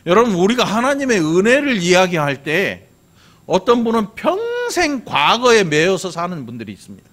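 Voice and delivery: emphatic high-pitched preaching; full-force preaching. Real, not acted. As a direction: proclaim it to the congregation, stretching a key word.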